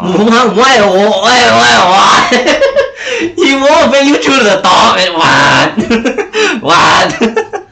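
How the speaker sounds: laughing disbelief. Real, not acted. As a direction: laugh out the protest. Laughing at game chat.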